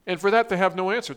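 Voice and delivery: ordinary delivery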